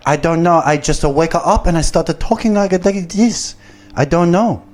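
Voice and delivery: high-pitched, singsong fake Japanese-Brazilian accent; exaggerated mock foreign accent, pitched well up; butchered foreign accent. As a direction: imitate a woman with a botched Japanese-sounding accent. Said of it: "I'm butchering that accent".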